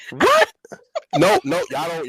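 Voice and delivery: high, loud disbelief; shrieking in shock. Real, not acted. Shocked at panel's answer.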